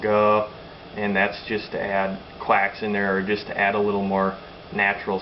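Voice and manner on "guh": guh, punched out louder; duck call note. Shown as a demonstration. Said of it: "some of them notes I string out".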